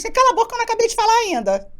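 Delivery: mais alto, mais agudo e rápido, cortando quem interrompe; imponha-se com um corte seco e alto; resposta ríspida. Retold or imitated. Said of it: "eu falei". (reagindo a uma interrupção na reunião, firme e alto)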